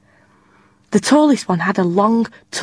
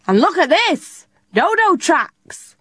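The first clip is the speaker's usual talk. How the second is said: excited pirate; 8 semitones higher, slower than usual. A narrator's reading. Pirate lad finds tracks.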